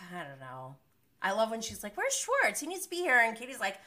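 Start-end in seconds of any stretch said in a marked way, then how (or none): 2.0-3.2 much higher mock calling voice; mock Lisa call. Recapper mimics Lisa demanding Schwartz come, high pitched.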